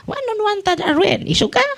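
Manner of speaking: squeaky high mocking demon voice; demon taunt. Priest mimicking the possessed in a high taunting falsetto. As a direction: needle him in a shrill falsetto jeer. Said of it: "itudlo ko niya".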